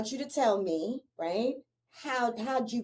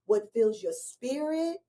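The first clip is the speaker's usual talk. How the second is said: ordinary talk, nothing marked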